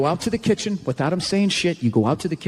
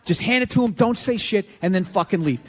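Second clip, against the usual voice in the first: usual speaking voice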